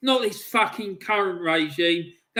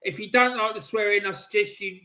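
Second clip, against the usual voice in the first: usual speaking voice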